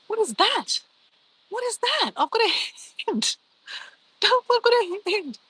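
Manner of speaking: high-pitched, giddy amazement; excited squeal. Real, not acted. Streamer reacting to a surprise gift on screen, excited squeal. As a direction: squeal it, stretching out the last words.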